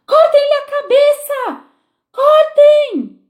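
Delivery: very high, loud, slow furious scream; screaming queen. A narrator's reading. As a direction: shriek the order like a wild beast. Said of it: "começou a gritar". (a rainha gritando furiosa, muito aguda e alta)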